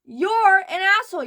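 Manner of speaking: loud, high insult; angry insult. Real, not acted. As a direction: bark it at him. Scolding the poster.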